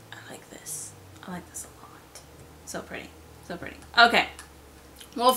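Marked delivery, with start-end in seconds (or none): 0.1-2.2 breathy awed whisper; hushed admiring whisper. Real, not acted. Whispered admiration of dragon art. 2.6-3.8 soft murmur. Real, not acted. Murmured admiration.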